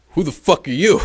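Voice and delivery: high, loud hostile challenge; retold angry yell. Schmokes challenging the angry dad at the door, loud high yell. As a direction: snap at the stranger in the doorway.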